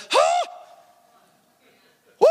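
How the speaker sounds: very high, loud acted whoop; gasp of wonder. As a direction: burst out with a startled, high whoop. Acts out Barnabas marvelling.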